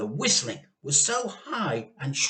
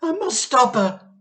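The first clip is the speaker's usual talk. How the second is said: high, loud wailing; wife wailing in despair; 8 semitones higher, 9 dB louder than usual. Acted. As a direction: wail it desperately. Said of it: "Missus Cranky wailed".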